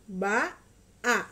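Ba and a each said loud and slow, apart; over enunciating. Shown as a demonstration. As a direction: sound out each syllable, Ba then a. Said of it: "ikutin Mama ya".

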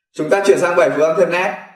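ordinary talk, nothing marked

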